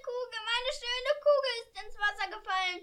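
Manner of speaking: hoch und laut, unter Tränen; weinerlich klagend. Acted. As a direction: klagen wie ein verzweifeltes Kind. Weint um die Kugel. (Königstochter klagt um Kugel: laut weinend)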